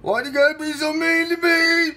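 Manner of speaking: loud, high-pitched hurt wail. Acted. Host to mean caller, loud hurt wail. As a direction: wail it like a wounded child. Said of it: "I said".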